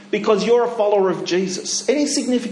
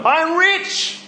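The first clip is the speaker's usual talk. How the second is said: higher, rising on rich; bright proclaiming tone; joyful declaration; 10 semitones higher than usual. Shown as a demonstration. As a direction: lift rich up with delight. Repeated confident declaration.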